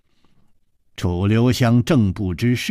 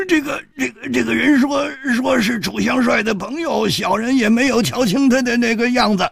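high, whimpering stammer; whining; 13 semitones higher than usual. Acted. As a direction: plead with a pained face. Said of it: "苦着脸说".

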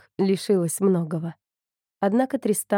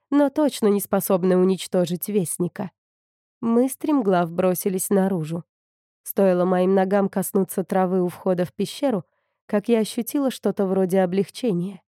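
ordinary delivery

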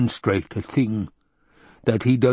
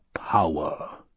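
lower, tempting whisper; whispered power; 6 semitones lower, 3 dB quieter than usual. A narrator's reading. The Baron tempting Piter, low whisper. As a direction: breathe the word as bait.

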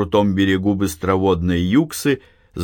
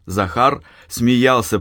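ordinary delivery, nothing marked

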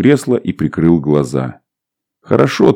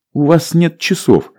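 smiling stewardess; 10 semitones higher than usual. A narrator's reading. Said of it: "улыбнулась девушка".

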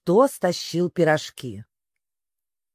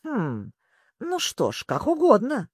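grumbling; 6 semitones higher than usual. A narrator's reading. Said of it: "проворчал он".

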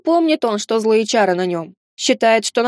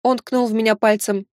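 plain reading voice

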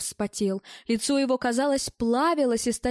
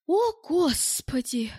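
high, slow sighing exclamation; weary sigh; 6 semitones higher, slower than usual. A narrator's reading. Irene, tired of her husband's boasting, sighs. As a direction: sigh it out, fed up. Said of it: "вздохнула Ирен".

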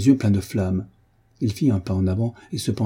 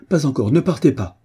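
urgent plea, higher and louder; raised, imploring outcry; crying out; 5 semitones higher, 5 dB louder than usual. A narrator's reading. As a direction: call out desperately to stop them leaving. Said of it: "s'écria-t-il".

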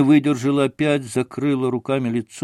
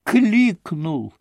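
cried out; 8 semitones higher than usual. Acted. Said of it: "прокричала она".

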